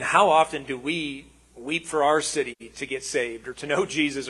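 usual speaking voice

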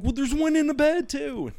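put-on very high, loud kid's wail; panicked child. Child warning dad of another spider, panicked wail. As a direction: squeal it as a frightened child, acted out.

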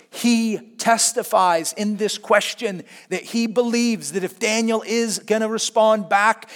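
usual speaking voice

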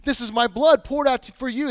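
fervent proclamation; impassioned quote. Retold or imitated. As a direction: proclaim the words with fervor. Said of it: "Like when Jesus says".

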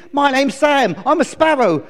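high, loud chirpy bird voice; eager little sparrow; cheerful sparrow. Acted. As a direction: chirp a perky introduction. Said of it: "he chirped cheerfully".

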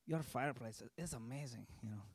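a hint of Francisco's accent, said warmly. Pastor quoting his stonemason's praise in a mild Spanish-tinged accent. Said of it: "he has this really cool accent".

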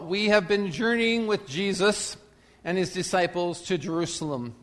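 usual speaking voice

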